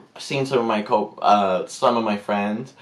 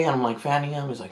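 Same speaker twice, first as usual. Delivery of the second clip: normal talking voice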